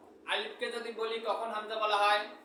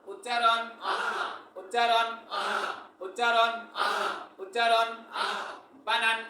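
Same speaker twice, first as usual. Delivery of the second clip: drill cues. Real, not acted. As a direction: call out each cue for the class to answer. Teacher calling drill cues to class. (জোরে ছন্দে ছন্দে নির্দেশ)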